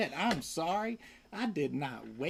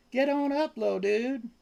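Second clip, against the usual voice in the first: coaxing pet talk; 6 semitones higher than usual. Real, not acted.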